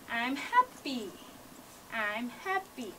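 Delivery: tender, slow demo of affection; gentle and kind. Shown as a demonstration. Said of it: "a gentle movement express that you are affectionate or kind".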